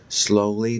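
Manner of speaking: plain reading voice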